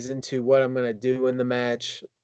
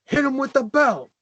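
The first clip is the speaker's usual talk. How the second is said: high-pitched mock yell; imitated fan yell; 10 semitones higher, 3 dB louder than usual. Fan up from his seat to wrestler, imitated yell. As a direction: call it out high, like a heckling fan. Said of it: "a fan got out of his seat and yelled".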